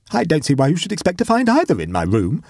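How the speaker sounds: high-pitched indignant retort; furious retort. A narrator's reading. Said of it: "retorted Waldo furiously".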